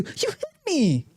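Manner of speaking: high, whining complaint; a high, wounded whine; whiny cry. Retold or imitated. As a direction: play the hurt crybaby. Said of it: "they say it just like that too".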